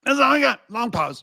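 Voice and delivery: high-pitched parroting caricature; mock pundit. Host parroting the clip, mocking pundit voice. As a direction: throw it out as mock oratory.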